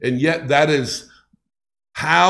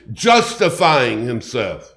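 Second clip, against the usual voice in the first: usual speaking voice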